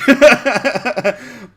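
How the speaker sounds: high, very loud burst of laughter; cracking up. Real, not acted. Streamer reacting to a friend's video, cracking up loudly.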